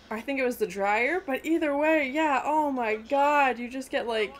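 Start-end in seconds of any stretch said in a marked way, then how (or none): none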